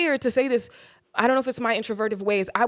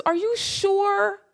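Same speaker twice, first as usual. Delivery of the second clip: put-on doubting girl; 8 semitones higher than usual. Girl doubting father's offer.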